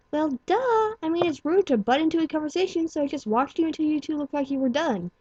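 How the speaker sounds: high, bubbly excited filly; Pinkie Pie voice. A narrator's reading. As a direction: squeak it out giddy and fast.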